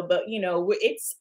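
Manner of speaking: usual speaking voice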